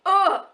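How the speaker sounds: loud, high "ugh"; exasperated groan. Real, not acted. Fan who just can't stop reading, exasperated groan. Can't resist the next book.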